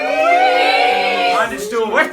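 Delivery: high, slow shouted reveal to the class; drawn-out shout. Shown as a demonstration. Museum guide shouting out to the class, high and slow. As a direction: stretch it out, loud and high, like a showman. Mangle demo with children.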